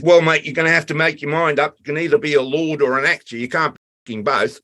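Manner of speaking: mock Aussie crew bloke. Crew member's blunt verdict retold.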